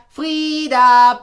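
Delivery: laut rufend; calling out. Acted. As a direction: ruf laut nach ihr. Ruft nach Frieda im Haus. (laut rufend nach Frieda)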